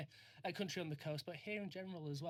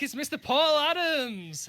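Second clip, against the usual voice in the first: loud, slow host announcement; announcer voice; 10 semitones higher, 15 dB louder, slower than usual. Acted. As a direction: boom it out like a ring announcer. Host announcing guest loudly.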